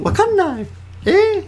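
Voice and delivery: very high-pitched ditzy voice; put-on high ditzy act; ditzy character. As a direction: squeak it out like an airhead character. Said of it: "ditzy, excuse me, ditzy character".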